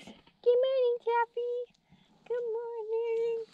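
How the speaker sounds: very high, soft pet-talk voice; cutesy pet greeting. Real, not acted. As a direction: coo a slow high good morning.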